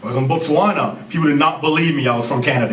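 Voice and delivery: ordinary talk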